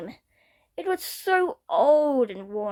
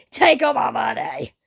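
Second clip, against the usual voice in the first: loud greedy cry of a buyer; eager shout; 2 semitones higher, 6 dB louder than usual. Acted. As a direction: shove the cash and yell it.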